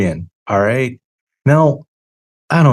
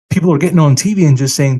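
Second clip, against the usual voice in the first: ordinary talk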